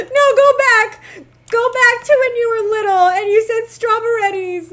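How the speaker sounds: high mock-crying plea; heartbroken pleading. Mother retelling her plea to her grown child, heartbroken mock wail. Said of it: "I was so heartbroken".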